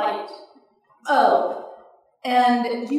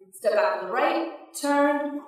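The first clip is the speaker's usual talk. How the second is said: plain reading voice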